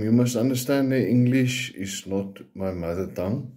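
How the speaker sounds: ordinary delivery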